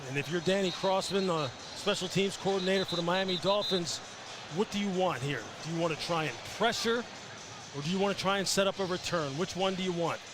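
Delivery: usual speaking voice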